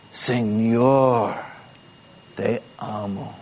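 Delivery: weight on "Señor", then let "te amo" drop; stress on Señor. Shown as a demonstration. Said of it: "Enfatizando la palabra". (acento en "Señor", "te amo" más suave)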